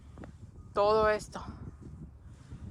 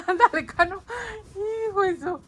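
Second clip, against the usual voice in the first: much higher, louder coaxing call; 11 semitones higher, 4 dB louder than usual. Real, not acted. Cheering on her dog Cano in the snow, high and loud.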